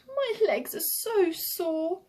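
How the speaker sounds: high, slow little-boy voice, fully voiced; whispering small boy. A narrator's reading. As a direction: complain in a drawn-out high child voice. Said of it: "the smallest boy whispered".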